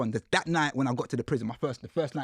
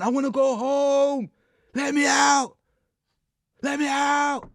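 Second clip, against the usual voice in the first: much higher, louder mock wail; mock crying grown man; 12 semitones higher, 7 dB louder, slower than usual. Grown men crying in prison cells, mocked with a high wail. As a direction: whine like a man begging to go home. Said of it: "grown men crying".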